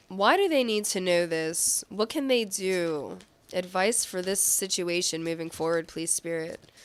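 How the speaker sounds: ordinary delivery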